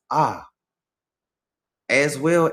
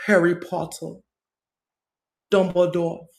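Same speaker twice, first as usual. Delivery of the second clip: higher, lightly voiced British accent; 6 semitones higher than usual. Naming British figures in a lightly mocking British accent. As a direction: say the names like a prim Brit. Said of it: "with actual British conversation".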